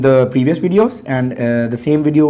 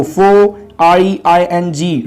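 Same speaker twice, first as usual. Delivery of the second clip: normal talking voice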